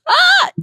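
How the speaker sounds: short high-pitched mock shriek; mock scream. Reviewer reacting to aliens, playful high scream. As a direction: yelp as if an alien appeared.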